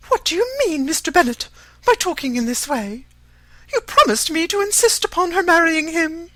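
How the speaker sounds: high, loud, outraged wife; indignant Mrs. Bennet. A narrator's reading. Disappointed wife reproaching her husband, indignant. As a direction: protest in a high, agitated voice.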